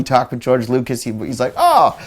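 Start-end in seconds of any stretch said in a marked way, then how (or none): 1.6-2.0 folksy alien believer. Retold or imitated. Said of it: "a guy that, like, believes in aliens".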